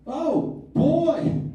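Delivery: put-on high, loud shocked "Oh, boy!"; involuntary alarmed exclamation; startled oh boy. Shown as a demonstration. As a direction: blurt it at a car crash. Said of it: "I heard myself go".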